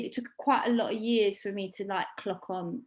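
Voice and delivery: ordinary talk, nothing marked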